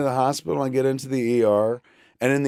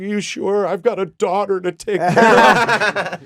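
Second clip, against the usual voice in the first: high-pitched mock worry, drawn out; imitated freaked-out wife; 7 semitones higher, 5 dB louder, slower than usual. Freaked-out wife asking medic for reassurance, imitated panic. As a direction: plead it high, hammed-up and anxious. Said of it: "Jennifer's all freaked out".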